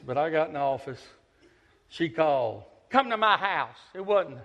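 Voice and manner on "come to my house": put-on bossy old woman on the phone; mock stern elderly lady; old lady's command. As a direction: order him over, curt and commanding.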